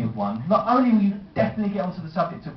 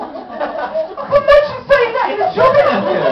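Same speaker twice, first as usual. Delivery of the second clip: shouted through real laughter, much higher and louder, slow; laughing shout; 8 dB louder than usual. Real, not acted. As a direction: crack up and yell it out in disbelief. Comedian laughing at own story.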